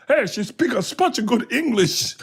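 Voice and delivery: high, slow, hammed-up cook; cook character voice. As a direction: draw it out in a high put-on voice. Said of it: "And he goes".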